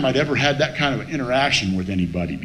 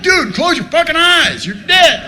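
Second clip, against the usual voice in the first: high, loud pleading shout; hammed-up yell, pitched way up; exasperated yell; 13 semitones higher, 6 dB louder than usual. Man trying to close dead friend's eyes, exasperated yell.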